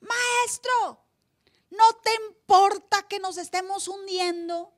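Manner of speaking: grito muy agudo y lento; gritando. Retold or imitated. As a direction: clama desesperado para que despierte. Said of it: "le gritaron". (los discípulos le gritan al Señor en la barca, desesperados)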